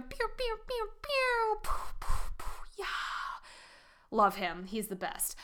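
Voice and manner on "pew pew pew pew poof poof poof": high squeaky pew pew zaps then breathy poofs; laser pew sound effects. Pretend. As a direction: zap it like a toy laser gun. Sound effects for brain system.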